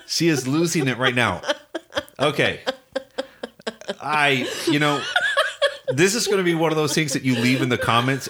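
can't keep a straight face, laughing. Real, not acted. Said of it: "she's laughing at me".

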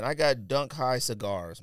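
usual speaking voice

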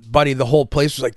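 ordinary talk

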